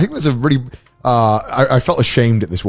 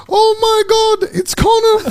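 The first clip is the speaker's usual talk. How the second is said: much higher, gushing with a laugh; excited mum. Retold or imitated. Mum spotting Connor, imitated in a high gushing squeal. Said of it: "she's like".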